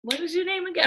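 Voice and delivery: mock forgetful. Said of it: "I'm just kidding".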